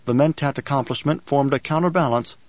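usual speaking voice

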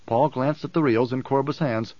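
usual speaking voice

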